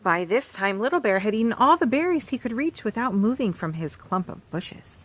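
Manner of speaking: usual speaking voice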